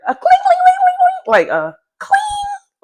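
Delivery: very high clink sound; imitated clinking. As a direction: mimic glass clinking, high and loud.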